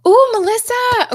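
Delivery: very high, loud drawn-out ooh; thrilled to see her pop in, slowly; excited squeal. Real, not acted. Greeting a viewer on a live stream.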